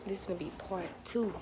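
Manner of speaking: usual speaking voice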